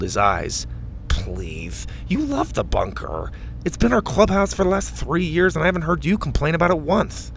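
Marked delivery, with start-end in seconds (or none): 1.1-1.9 breathy whispered please; whispered scoff. A narrator's reading. Mac scoffing reply. 2.1-7.3 put-on higher lisping kid voice; Mac's voice. A narrator's reading.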